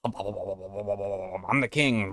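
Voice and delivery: low, soft, drawn-out blubbering babble; muttered royal gibberish; babbling mock king. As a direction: blubber nonsense as a silly king.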